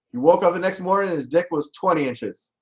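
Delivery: ordinary delivery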